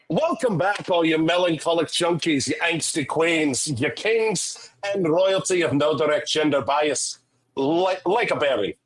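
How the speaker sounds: second go at Irish, still Scottish; put-on Scottish-Irish brogue, close enough; Scottish accent. Shown as a demonstration. Host retrying the welcome to listeners, in a rough Scottish-Irish accent. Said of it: "This is still gonna be Scottish".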